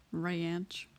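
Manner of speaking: "ranch" said slowly as "ray-anch"; stretched ranch vowel. Shown as a demonstration. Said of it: "R A Y A N C H".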